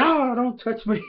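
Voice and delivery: high-pitched put-on cry; mock recoiling protest. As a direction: squeal it as if recoiling. Character recoils at being touched.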